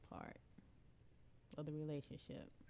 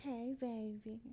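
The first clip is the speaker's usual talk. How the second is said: slow, drawn-out sexy come-on; 5 semitones higher, slower than usual. Shown as a demonstration. Wife showing husband her little sexy voice on request, sexy. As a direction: purr it slowly like a seduction. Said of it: "What is your sexy voice".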